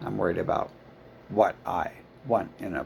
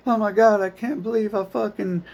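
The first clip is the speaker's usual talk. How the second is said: mocking upset student; 9 semitones higher, 4 dB louder than usual. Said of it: "it's never like".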